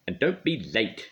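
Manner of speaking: sharp, loud order down the phone; Little Miss Bossy snapping a warning; bossy shout. A narrator's reading. Bossy lady shouting a warning down the phone. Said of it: "she shouted down the phone".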